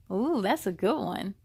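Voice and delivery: ordinary delivery, nothing marked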